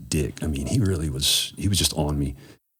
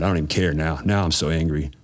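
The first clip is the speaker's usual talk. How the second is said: usual speaking voice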